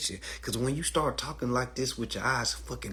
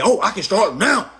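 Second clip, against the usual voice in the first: eager new hire; 7 semitones higher, 9 dB louder than usual. Retold or imitated. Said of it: "He just said".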